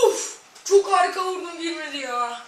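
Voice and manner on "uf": high laugh into an "uf"; laugh, then a frustrated "uf"; laughing groan. Real, not acted. Reacts to a missed shot.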